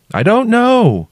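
very high called reply; calling out. A narrator's reading. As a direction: call back, unsure. Shouted reply at the crack.

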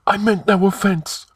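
high, anxious apology; trembling. A narrator's reading. High priest placating the offended king, anxious. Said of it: "The high priest was trembling".

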